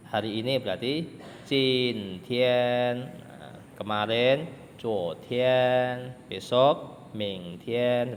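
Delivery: slow, deliberate vocabulary model; slow teaching. Shown as a demonstration. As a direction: spell out Jin Tian, Zuo Tian, Ming Tian slowly for the class. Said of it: "teacher slowly models words".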